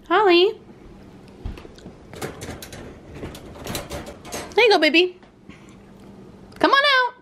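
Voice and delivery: high, singsong coaxing; doting pet talk. Real, not acted. Owner coaxing Holly out, high doting baby talk. Calling and cooing to another pet.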